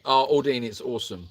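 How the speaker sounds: lightly put-on American accent. Shown as a demonstration. Answering a viewer's question about accents, light American accent. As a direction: try a casual American accent. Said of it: "How is your American accent going".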